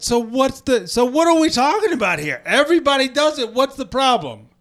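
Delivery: high-pitched, indignant protest; exasperated retort. Retold or imitated. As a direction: argue back as if he were standing here. Imagined retort to the landowner.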